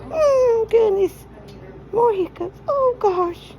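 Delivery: usual speaking voice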